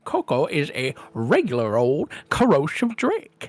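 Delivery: high, loud gushing gnome; gnome salesman. Acted. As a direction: sell it like an excited little gnome. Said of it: "he's like".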